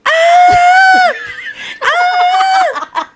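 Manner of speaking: very high startled shriek; Hmong old lady scream. Retold or imitated. Showing friends the auntie's scream, high shriek. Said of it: "it's exactly like that tone".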